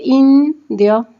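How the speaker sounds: "indio" split as in, dio; syllable by syllable. Shown as a demonstration. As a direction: hold "in", pause, then "dio" in "indio". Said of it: "formado por una sola consonante".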